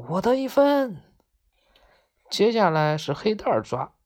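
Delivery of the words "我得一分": high-pitched triumphant cry; joyful yell. A narrator's reading. Maomao celebrating a catch, joyful high yell. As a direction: crow about scoring. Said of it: "他高兴地大喊大叫".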